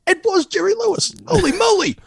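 high, amazed exclamation; surprised. Real, not acted. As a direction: squeal it out in delight. Surprise at credits.

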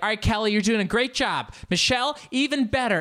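high, loud, upbeat instructor voice; instructor praise. Retold or imitated. Imitating the instructor praising other students. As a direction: cheer the class on by name. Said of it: "she'll be like".

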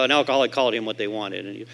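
ordinary talk

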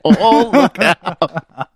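laughing, loud look out; excited. Real, not acted. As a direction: whoop it up, teasing. Reacting loudly to the sexy voice.

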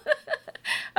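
high, breathy giggle; laughing. Real, not acted. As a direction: giggle under your breath. Laughs at first date joke.

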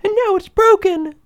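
loud, squeaky cub cry; Baby Bear. A narrator's reading. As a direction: wail as a heartbroken bear cub. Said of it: "cried in his tiny little voice".

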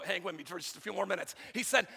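normal talking voice

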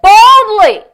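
loud, emphatic preacher's punch; forceful and ringing; bold. Retold or imitated. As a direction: punch it out boldly. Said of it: "You've got to get bold".

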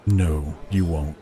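low, slow, blunt refusal. Acted. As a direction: say it bluntly, unhurried and deep. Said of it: "His voice was as blunt as his honest answer".

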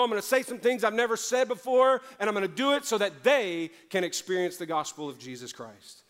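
loud, high, full-force preaching prayer; impassioned prayer. Acted. Preacher voicing a surrender prayer to God, impassioned and loud. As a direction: build into a fervent cry to God.

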